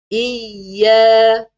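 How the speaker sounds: "Iya" loud, held on the doubled y; pressed doubling. Shown as a demonstration. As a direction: lean hard on y in "Iya". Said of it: "kena tekan". (tekan kuat sabdu "Iya")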